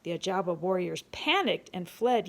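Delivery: ordinary delivery, nothing marked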